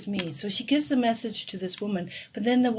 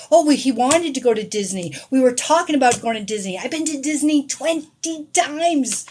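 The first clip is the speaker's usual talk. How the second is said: loud, eager mock claims; eager rival claimants; 5 semitones higher, 7 dB louder than usual. Other viewers typing to claim the message, mock eagerness. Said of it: "these people are there, are typing too, going".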